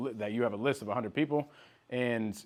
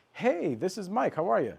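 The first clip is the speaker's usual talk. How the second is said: super welcoming; 7 semitones higher than usual. Shown as a demonstration. Salesman greeting a lady on the phone, super welcoming. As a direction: welcome them in a much higher voice. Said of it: "it's super welcoming".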